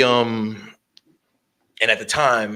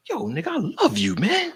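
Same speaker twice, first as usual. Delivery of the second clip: imitating an excited fan, much higher and fast; mock gush, squeaky high and quick; hammed-up high fanboy voice; 14 semitones higher, 3 dB louder than usual. Gang cop gushing over host.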